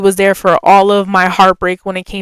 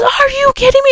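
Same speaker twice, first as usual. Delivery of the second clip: tearful disbelief; faster than usual. Retold or imitated. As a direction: voice cracking high on the verge of tears. Kelly learns the truth.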